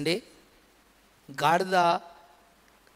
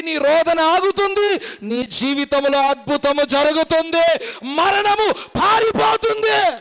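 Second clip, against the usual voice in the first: loud, high, impassioned sermon climax, nearly shouting; fervent preacher's rising cry; preaching at full force; 9 semitones higher, 6 dB louder than usual. Acted. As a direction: thunder it out to the congregation.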